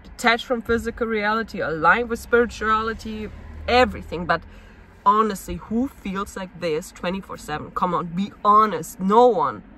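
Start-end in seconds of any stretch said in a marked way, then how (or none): none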